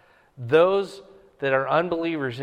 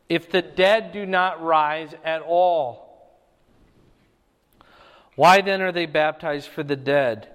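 louder, raised preaching read of the passage; scripture reading; 2 semitones higher, 5 dB louder than usual. Acted. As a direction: proclaim the verses to the congregation. Reads scripture aloud in sermon.

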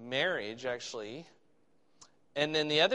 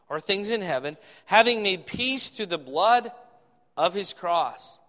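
emphatic Bible reading, swelling on key phrases; scripture reading; 2 semitones higher, 8 dB louder than usual. Acted. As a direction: read it out like a proclamation. Reads scripture aloud.

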